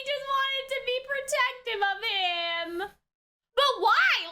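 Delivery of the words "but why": exasperated. Real, not acted. Frustrated question at scene.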